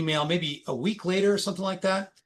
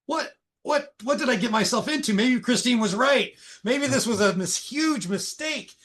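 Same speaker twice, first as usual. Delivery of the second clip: much higher, rising alarm in the voice; new hire watching layoffs, alarmed and high-pitched; panicked inner thoughts; 7 semitones higher than usual. Retold or imitated. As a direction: think it aloud in a panic, pitched way up. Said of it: "and I'm thinking".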